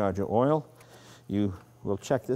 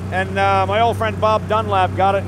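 talking loudly and higher over a roaring engine; raised voice over engine; 11 semitones higher, 9 dB louder than usual. Real, not acted. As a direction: shout over the motor while driving. Talking while driving over engine noise.

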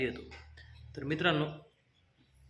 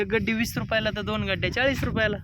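vendor pitch; 7 semitones higher, 4 dB louder than usual. Acted. Answering customer as seller.

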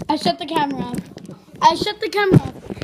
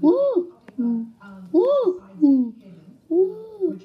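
alternating woo; much slower than usual. Shown as a demonstration. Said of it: "I can't do that with my voice".

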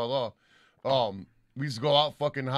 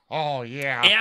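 Bobby's oh yeah; 6 dB louder than usual. Retold or imitated. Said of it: "that was like a Bobby saying it".

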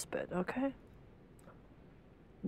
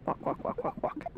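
whispering; 5 dB quieter than usual. Acted. Narrating own walking.

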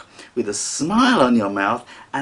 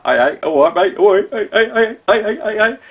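higher, louder mock cockney pub shout; 8 semitones higher, 6 dB louder than usual. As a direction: ham up a cockney bloke in a pub, calling out. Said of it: "some American that wants to be a cockney".

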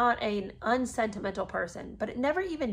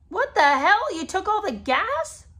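loud, high-pitched incredulous outburst; outraged disbelief; 11 semitones higher, 8 dB louder than usual. Acted. Laboring wife to husband who took the gas, outraged. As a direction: explode at him in acted indignation.

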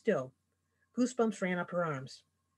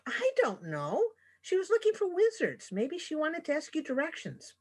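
lightly voiced eager little griffin, a touch higher; hint of a cute young griffin voice; young griffin child; 9 semitones higher than usual. A narrator's reading. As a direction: chatter like a proud young creature. Raffi voiced.

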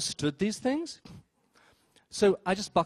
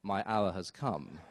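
slow, low, soft villain line; 7 semitones lower, 7 dB quieter, slower than usual. Retold or imitated. Thinking of the show, mock villain intoning slowly. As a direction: savour it like a movie villain. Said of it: "And I thought".

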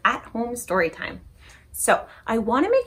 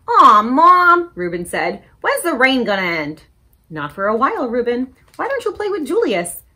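put-on lively picture-book read-aloud for kids; story time reading; 3 semitones higher, 6 dB louder than usual. A narrator's reading. Librarian reading a story to children, animated. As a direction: read it bright and animated to little listeners.

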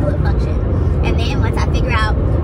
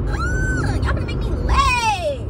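high, drawn-out exasperated yell; frustrated shout; 11 semitones higher, much slower than usual. Real, not acted. Driver yelling at a wide load making her late. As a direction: wail at the slow traffic.

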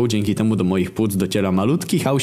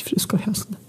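tearful whisper; 11 semitones higher, 4 dB quieter than usual. A narrator's reading. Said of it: "płaczliwym głosem macocha".

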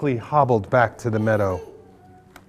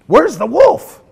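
higher, louder villager cry; 12 semitones higher, 8 dB louder than usual. Acted. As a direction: call out in alarm. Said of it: "a villager cried out".

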